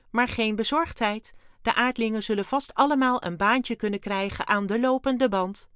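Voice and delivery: high, loud alien voice, salesman-like patter; breezy alien Wim. A narrator's reading. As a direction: reassure airily as the little alien. Wim explains. (hoge, luide, luchtige ruimtewezenstem)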